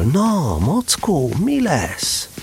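a tickling inner voice urging Pooh on; nagging inner voice. Acted. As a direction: needle Pooh from inside.

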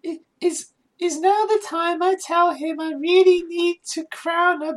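put-on high-pitched German accent; stagey German accent reading smut. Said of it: "Do it in a German voice".

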